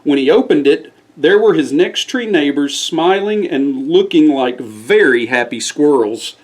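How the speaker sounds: ordinary delivery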